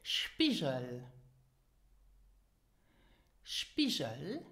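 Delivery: "Spiegel" with zh for the g; SHPEE-zhel, carefully modelled "Spiegel"; pronunciation example. Shown as a demonstration. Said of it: "repeat after me".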